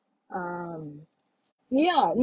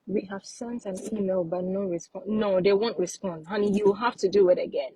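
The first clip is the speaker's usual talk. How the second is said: ordinary talk, nothing marked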